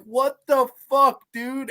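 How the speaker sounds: slow, high-pitched mock bafflement; drawn-out incredulous reaction; retold disbelief. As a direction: replay being utterly thrown. Acting out his baffled reaction.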